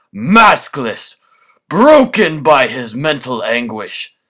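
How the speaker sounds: villainous rant. Acted. Villain rant builds to triumph.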